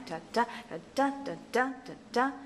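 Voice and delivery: rhythmic "da DA da DA" chant; weak-strong pattern. Shown as a demonstration. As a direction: beat out "da, da" alternating light and heavy. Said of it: "You can hear that pattern".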